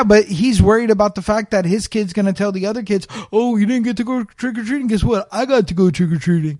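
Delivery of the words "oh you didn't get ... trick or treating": put-on higher bragging child voice; a kid showing off to friends. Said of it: "You're doing Just Rain's kid voice".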